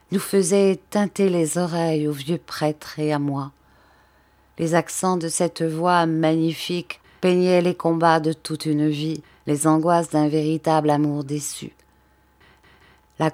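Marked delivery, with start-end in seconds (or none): none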